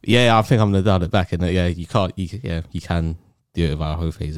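ordinary talk

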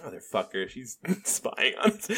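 super chill. Shown as a demonstration. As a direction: murmur it, dead calm. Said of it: "Super chill".

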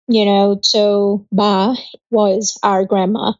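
ordinary talk, nothing marked

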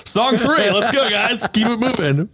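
high-pitched, hurry-up holler; mock impatient urging. Urging the stalled parade along, mock impatient. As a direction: chivvy them along, exaggeratedly impatient.

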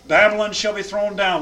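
solemn scripture reading to a class. Acted. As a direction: read the verses out with weight. Reads scripture aloud.

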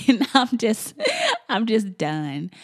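laughing through real tears; half laugh, half cry, slow; teary laugh. Real, not acted. Mom overcome about daughter's first school day, teary laugh. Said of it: "getting a little teary-eyed".

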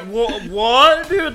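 high, loud confused what; bewildered drunk; imitating drunk man. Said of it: "And he's like".

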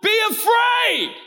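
high, drawn-out call; shouted reassurance. Acted. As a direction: call it across a raging storm. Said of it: "Even when he says".

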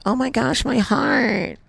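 high-pitched teasing impression of the wife cooing; gushing dog lover. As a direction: squeal it like she's melting over a puppy. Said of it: "so you can be like".

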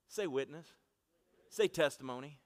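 ordinary talk, nothing marked

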